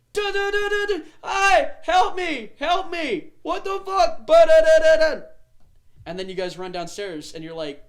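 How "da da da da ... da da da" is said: very high, loud frantic yelling; hammed-up screams from a trapped captive; cries for help. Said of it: "from downstairs, you hear".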